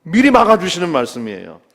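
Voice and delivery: ordinary talk, nothing marked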